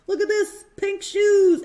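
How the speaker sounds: put-on loud, high thrilled little boy; boy thrilled with new pink shoes, loud and high; delighted. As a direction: gush over the shoes like a happy kid.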